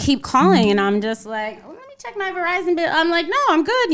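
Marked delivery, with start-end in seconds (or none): 1.7-3.9 acted out in a much higher voice; airy high voice, feigning a casual brush-off; high-pitched brush-off. Brushing off a suspected bill collector, high-pitched. Acting out dodging unknown callers.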